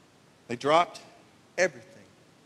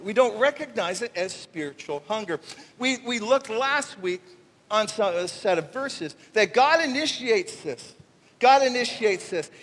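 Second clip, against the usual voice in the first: usual speaking voice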